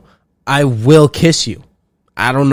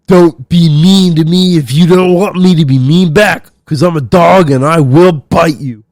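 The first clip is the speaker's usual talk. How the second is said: loud, higher put-on tough-guy voice reading a meme; mock tough meme quote; 4 semitones higher, 6 dB louder than usual. Mocking a tough uncle's meme, loud put-on voice. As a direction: ham up a badass meme caption. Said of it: "a quote saying, like".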